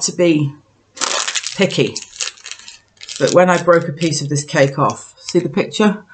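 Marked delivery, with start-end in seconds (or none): none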